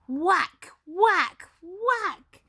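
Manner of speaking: rising, punchy whacks; loud whacks. A narrator's reading. Narrator sounding the axe chopping, loud punchy whacks. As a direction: hit each whack like the axe blow. Mother chops the beanstalk.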